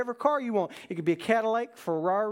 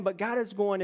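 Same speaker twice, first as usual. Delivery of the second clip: usual speaking voice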